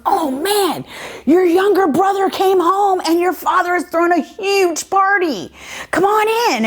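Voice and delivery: high-pitched, eager news-bearer; excited servant. Retold or imitated. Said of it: "the guy goes".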